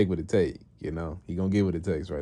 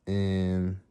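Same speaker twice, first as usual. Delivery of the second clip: ordinary delivery, nothing marked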